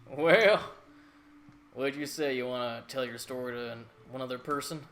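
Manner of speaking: loud hearty country governor; a jovial governor calling out; country accent. Acted. Governor voiced by GM.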